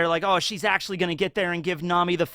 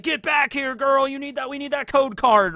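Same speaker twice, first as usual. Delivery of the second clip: higher, hammed-up chasing yell; mock chasing Marine; 9 semitones higher than usual. Marines chasing the girl across the ship, mock yelling. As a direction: call after her like a cartoon soldier.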